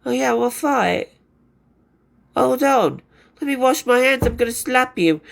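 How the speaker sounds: mock passive-aggressive rock star; lightly acted passive-aggressive put-down. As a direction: imitate a rocker's sulky, veiled threat. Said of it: "that kind of passive aggressive".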